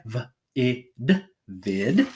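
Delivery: "vid" sound by sound with a quick ih; short I. Shown as a demonstration. Teacher reading a closed syllable, short ih in "vid". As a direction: sound out "V, I, d" with ih, then blend "vid". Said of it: "read it with short I".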